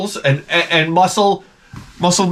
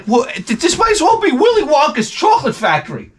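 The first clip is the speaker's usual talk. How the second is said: way up high in awestruck disbelief; amazed kid; 11 semitones higher than usual. Retold or imitated. Boy seeing his friend's candy drawer, high astonished wonder. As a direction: gasp at the candy like it's a wonderland. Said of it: "I'm like".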